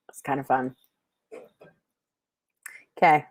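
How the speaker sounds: usual speaking voice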